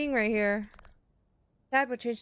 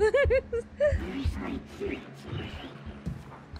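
high airy giggle; wheezy laugh; breathy laughter; 14 semitones higher than usual. Real, not acted. Laughing at game.

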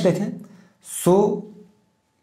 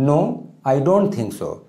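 slow dictation; much slower than usual. Shown as a demonstration. Teacher dictating model sentence.

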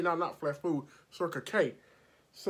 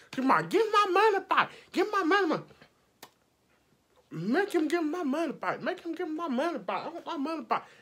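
mock demanding client; 10 semitones higher than usual. Client mimicked demanding her money back, high hammed-up yell. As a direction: squawk like an outraged customer.

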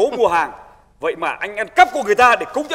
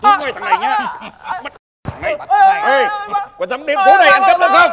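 scolding shout; 9 semitones higher than usual. Acted. Veteran scolding caught thief.

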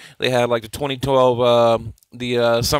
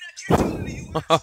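laughing; 3 dB quieter than usual. Real, not acted. Laughing at the clip.

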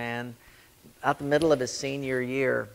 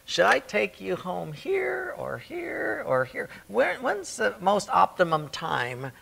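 pondering God; 8 semitones higher, slower than usual. Retold or imitated. God musing aloud.

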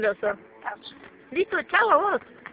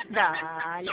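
usual speaking voice

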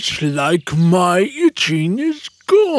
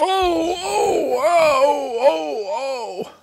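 itchy groans; 5 semitones higher, 4 dB quieter, slower than usual. Acted. Lion groans scratching.

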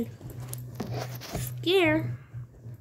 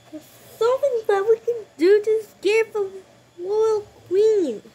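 excited scheming; 7 semitones higher than usual. Pretend. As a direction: burst out with the idea. Excited minion plan.

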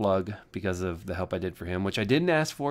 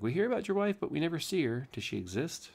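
viewers asking; 8 semitones higher, 4 dB quieter than usual. Retold or imitated. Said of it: "They're like".

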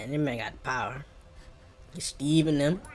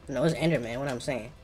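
usual speaking voice

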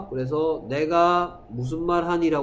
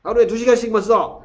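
loud, high, fast order; scolding parent; 7 semitones higher, 9 dB louder, faster than usual. Retold or imitated. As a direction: lay down the rule angrily. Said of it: "막 이러면서".

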